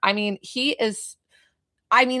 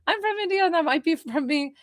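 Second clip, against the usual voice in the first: much higher, light voice; high quoted commenter; 11 semitones higher than usual. Retold or imitated. Quoting a YouTube viewer in a much higher voice. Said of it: "somebody over on, uh, YouTube saying".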